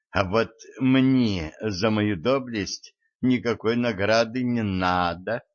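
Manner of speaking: plain reading voice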